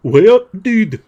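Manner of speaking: loud, high stab at Geordie; playful Geordie, breaking into a laugh; attempted Geordie accent. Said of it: "trying to do a Geordie accent".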